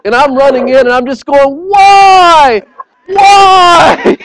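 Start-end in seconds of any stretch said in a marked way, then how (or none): 1.7-4.2 high frantic shout of why; panicked yelling. Retold or imitated. Dad yelling at the overflowing toilet, frantic shout. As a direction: yell it in panic at the flood.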